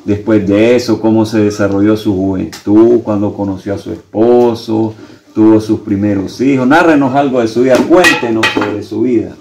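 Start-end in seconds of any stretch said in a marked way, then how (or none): none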